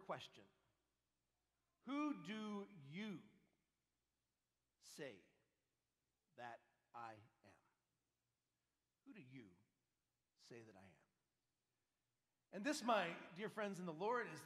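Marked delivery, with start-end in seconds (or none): 1.9-7.6 drawn out word by word; slow, weighty question. Acted. 9.0-12.5 much softer, slow and low; hushed repeat. Acted. Repeated quietly, almost hushed.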